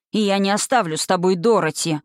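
ordinary delivery, nothing marked

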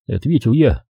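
ordinary delivery, nothing marked

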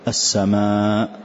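"السماء", slow, with the long vowel drawn out; "السماء" with a stretched madd. Shown as a demonstration. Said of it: "فنمد". ("السماء" بمد متصل ممدود)

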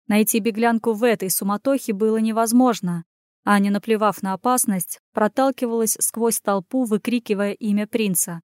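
ordinary delivery, nothing marked

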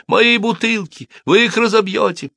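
high-pitched wailing protest; wailing shopkeeper. A narrator's reading. Shopkeeper protesting to soldiers about bottles, wailing. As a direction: protest in a very high, stretched wail. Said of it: "завопила гражданка Плюмо".

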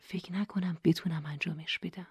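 hushed, breathy admission of doubt; whisper. A narrator's reading. Nora leaning to her brother before her speech, whispering. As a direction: whisper it so only your neighbour hears. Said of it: "نجوا کرد".

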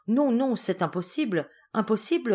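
high, insistent refusal to believe; distressed denial. A narrator's reading. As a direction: protest in mild panic, repeating it. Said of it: "répéta-t-elle". (dénégation affolée, voix plus aiguë)

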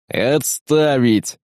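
drawn-out command at normal volume; mild barked order. A narrator's reading. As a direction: cut off dissent with a long-stretched order. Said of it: "гаркнул Тарков". (сдержанный командный окрик, протяжно)

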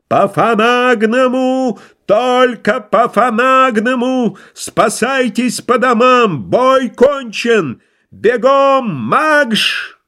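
high, slow, howled bugle-call command; like a cavalry bugle. Acted. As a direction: howl it like a cavalry trumpet. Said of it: "провыл, как кавалерийская труба". (протяжно, как кавалерийская труба)

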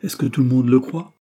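high, loud, indignant; a girl still angry at the gossip; heated question. Acted. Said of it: "avec emportement".

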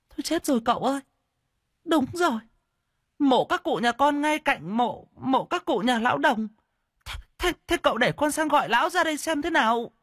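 very high-pitched flustered woman's voice; stammering in alarm. A narrator's reading. A neighbour woman realises whose ghost it is, alarmed stammering. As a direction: squeal it in a panic, tripping on words. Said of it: "bà mới lắp bắp".

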